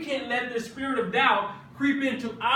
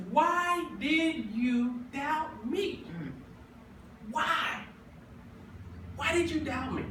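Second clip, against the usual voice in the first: tender Jesus; 3 semitones higher, 6 dB quieter, slower than usual. Retold or imitated. Jesus gently asking Peter why he doubted, slow and soft. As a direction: ask it gently, lingering on why. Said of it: "He says".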